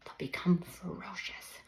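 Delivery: usual speaking voice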